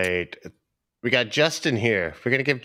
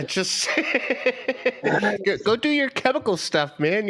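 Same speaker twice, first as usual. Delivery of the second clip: laughing; 10 semitones higher than usual. Real, not acted. Teasing through laughter, high.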